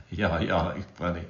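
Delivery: soft chuckling words; quiet amused laugh while speaking; laughing softly. Real, not acted. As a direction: laugh through it at the chat. Laughing at viewers' comments.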